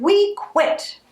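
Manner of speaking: high, defiant holler. Acted. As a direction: yell it back at the boss. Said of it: "they hollered".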